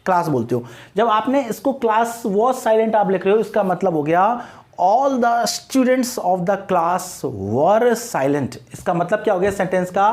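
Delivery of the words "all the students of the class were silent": very slow, every word spaced out; slow dictation. Shown as a demonstration. Teacher dictating the sentence's meaning slowly. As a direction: spell the meaning out word by word for students. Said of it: "slow explanatory dictation".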